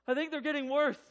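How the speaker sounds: high, loud acted worry; urgent alarm. Wife telling husband about contractions, urgent and loud. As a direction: raise pitch and volume with rising urgency.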